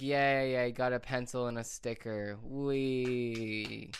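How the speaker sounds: mock celebration with a long drawn-out wee; sarcastic cheer. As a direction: fake being thrilled by a lousy prize. Mock joy at a lousy prize.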